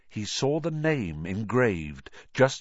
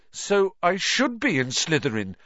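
desperate; 10 semitones higher, 4 dB louder than usual. A narrator's reading. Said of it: "looking desperately".

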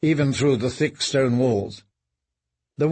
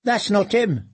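put-on boy voice; 7 semitones higher than usual. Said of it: "said a boy's voice".

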